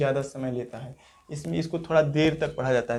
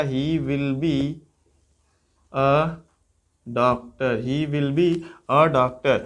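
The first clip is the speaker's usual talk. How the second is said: slow dictation; 2 semitones lower, much slower than usual. Shown as a demonstration. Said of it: "teacher models sentence slowly".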